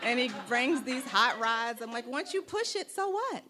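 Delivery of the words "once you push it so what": incredulous, pitched way up; high-pitched mock bafflement; baffled aunt. Aunt unimpressed by nephew's hot rods, high and incredulous.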